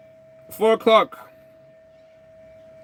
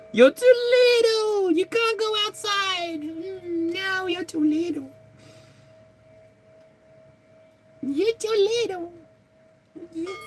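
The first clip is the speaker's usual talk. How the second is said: put-on very high laughing baby talk; baby talk to pet. Telling her pet it's too little to go outside, very high. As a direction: squeal at the pet through a laugh.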